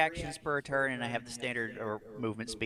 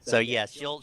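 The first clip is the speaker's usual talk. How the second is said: ordinary talk, nothing marked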